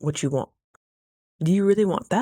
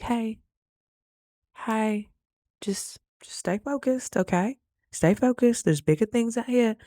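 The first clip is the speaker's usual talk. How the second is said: higher-pitched, coaxing little reminder; gentle nudge; 5 semitones higher than usual. Retold or imitated. Reminding oneself to keep perspective, gently coaxing. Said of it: "It was just a little like".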